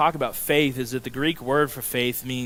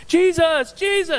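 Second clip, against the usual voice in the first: high, loud calling out a name; yelling; 5 dB louder, slower than usual. Retold or imitated. Blind man calling to Jesus over the crowd, yelling. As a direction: cry out across a crowd. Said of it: "He starts yelling".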